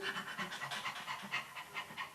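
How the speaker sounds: quick run of soft unvoiced puffs; rapid soft noises. Shown as a demonstration. Teacher shows student how everything else is just light, rapid soft noises. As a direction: imitate light running notes in whispered ticks. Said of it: "Everything else is just".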